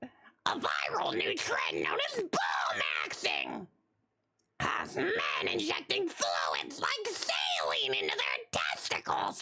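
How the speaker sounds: hammed-up Toad character voice. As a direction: read the headline as a squeaky, whispery toad. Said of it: "now reading the Toad voice".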